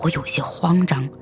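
ordinary delivery

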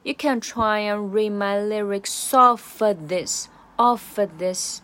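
slow "off of this" with "of" shrunk to "uh"; "of" weakened to schwa. Shown as a demonstration. Teacher demonstrating weak "of" in "off of this", slowed down. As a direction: reduce the "of" before "this" to a schwa. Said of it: "直接弱化成schwa".

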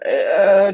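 loud, high mock stretching sighs; stretching groans. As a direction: stretch out loud as if just waking up. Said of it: "伸懒腰那种声音啊".

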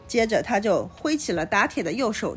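ordinary delivery

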